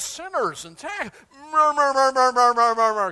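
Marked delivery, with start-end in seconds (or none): 1.5-3.1 louder, higher mocking murmur; loud mock grumbling. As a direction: drone the murmurs like a crowd complaining. Mimicking grumbling crowd.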